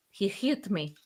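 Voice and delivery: mock Greek accent, said softly; quietly put-on Greek accent. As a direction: do a soft, jokey Greek accent. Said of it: "that's my Greek accent".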